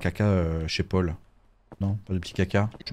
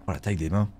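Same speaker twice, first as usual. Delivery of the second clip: ordinary talk, nothing marked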